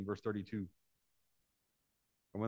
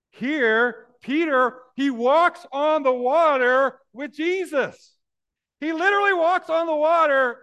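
preaching at a full-throated roar, high and loud; full-force shout; 15 dB louder, slower than usual. Real, not acted. Preacher roaring to his congregation about Peter. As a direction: bellow it to the back pews.

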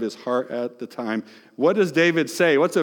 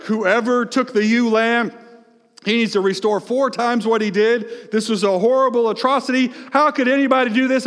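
indignant outburst, raised high; angry David; 9 semitones higher, 5 dB louder than usual. Retold or imitated. David demanding justice for the lamb, indignant. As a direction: rage at the thief of the lamb. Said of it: "David gets so angry".

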